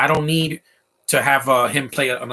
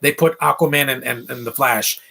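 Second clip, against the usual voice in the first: usual speaking voice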